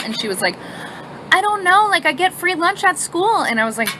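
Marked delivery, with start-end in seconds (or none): 1.3-3.4 put-on high, slow, innocent kid voice; a hint of a clueless kid; innocent daughter. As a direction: play dumb, slow and high.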